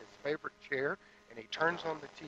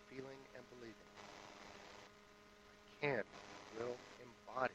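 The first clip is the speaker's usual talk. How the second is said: hushed contemplative guided reflection; guided meditation; 7 semitones lower, 16 dB quieter than usual. Acted. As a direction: lead the room inward, hushed and unhurried. Guided meditation opening service.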